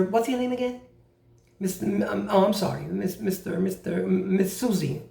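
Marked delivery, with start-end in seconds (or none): none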